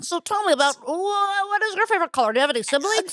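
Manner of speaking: hammed-up dinner date interviewer; mock interview date. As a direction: play the stiff date quizzing. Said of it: "you're like".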